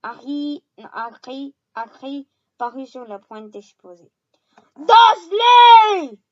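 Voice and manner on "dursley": loud, high furious roar. A narrator's reading. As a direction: scream the name in rage. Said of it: "hurla-t-il".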